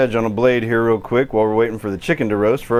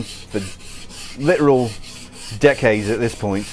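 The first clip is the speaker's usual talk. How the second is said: put-on London accent; American doing an amateur London accent. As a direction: chat casually in an imitation London accent. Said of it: "this is probably a shite accent".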